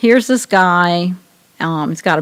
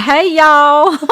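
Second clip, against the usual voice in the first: playful, higher, louder Southern twang greeting; mock Southern slang; 8 semitones higher, 6 dB louder than usual. Joking about coming back from Alabama, in a mock Southern accent. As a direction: ham up a Southern drawl for a laugh. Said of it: "you're gonna be talking really slangy".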